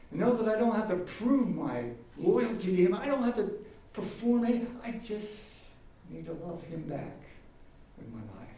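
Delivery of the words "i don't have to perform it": ordinary delivery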